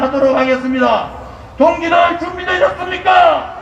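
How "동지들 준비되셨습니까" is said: roaring rallying speech. Acted. Rally call to crowd.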